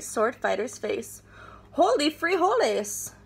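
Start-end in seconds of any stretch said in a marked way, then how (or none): 1.7-3.1 high, surprised exclamation; excited cry. A narrator's reading. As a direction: cry out in amazement. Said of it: "cried Scipito".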